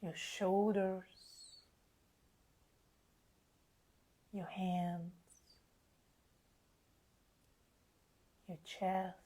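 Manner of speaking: slow, spacious meditation voice with long pauses; guided meditation. Acted. Healer easing live listeners into relaxation under the full moon, slow guided meditation. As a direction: guide them gently into relaxation, unhurried.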